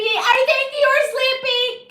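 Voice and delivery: high loud slow teasing repeat; teasing sing-song. Pretend. Streamer teasing a sleepy viewer, loud slow sing-song. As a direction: stretch it out to tease.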